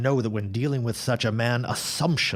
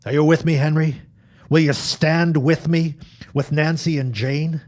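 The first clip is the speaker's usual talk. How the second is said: louder and higher, rallying a friend; fervent call to arms; rousing call; 6 semitones higher, 8 dB louder than usual. Acted. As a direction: swear him in with a stirring cry. Rallying Henry to stand together.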